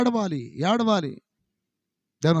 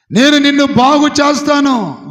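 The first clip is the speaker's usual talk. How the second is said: higher and much louder, ringing out; loud proclamation; 7 semitones higher, 10 dB louder than usual. Acted. Preacher voicing God's promise to Hezekiah, loud ringing shout. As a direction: shout the promise to the whole hall.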